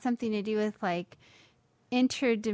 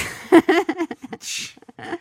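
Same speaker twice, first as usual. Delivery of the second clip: loud laugh; 9 semitones higher, 11 dB louder than usual. Real, not acted. Wife laughs at sultry voice.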